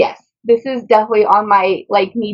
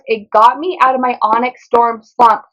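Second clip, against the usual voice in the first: normal talking voice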